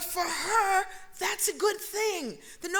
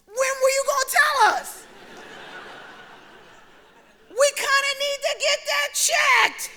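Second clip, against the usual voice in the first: loud, higher, laughing scold; mock scolding; 8 semitones higher, 6 dB louder than usual. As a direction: tease mom with a loud laughing rebuke. Daughter scolding mother, laughing.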